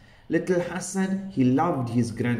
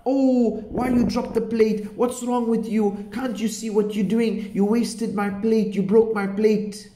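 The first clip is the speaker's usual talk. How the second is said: mock scolding shout; 6 semitones higher than usual. Mommy scolding grandfather over the broken plate, mock shout. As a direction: play the cross mommy scolding, storytelling style. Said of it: "she was shouting the grandfather".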